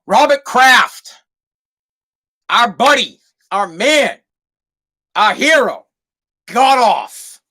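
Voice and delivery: big announcer delivery, pausing between phrases; mock-heroic announcement. Host hails team owner as hero, mock-grand announcement. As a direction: proclaim it grandly, stretching the name.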